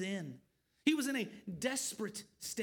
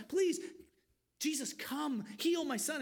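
raised, urgent begging, higher; desperate plea; 5 semitones higher than usual. Retold or imitated. Desperate father calls out to Jesus, raised urgent plea. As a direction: cry out to Jesus for your son.

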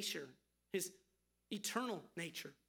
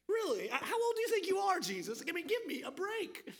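scoffing, pitched up high and loud; mocking disbelief; 9 semitones higher, 4 dB louder than usual. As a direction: sneer at a young upstart claiming to be old. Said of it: "they're mocking Jesus".

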